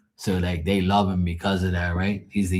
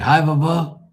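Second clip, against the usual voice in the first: nephew greeting; 8 semitones higher, 4 dB louder than usual. Retold or imitated. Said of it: "So he's like".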